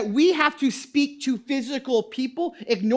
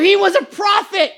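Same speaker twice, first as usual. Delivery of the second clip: mock shout; 6 semitones higher, 6 dB louder than usual. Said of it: "You know".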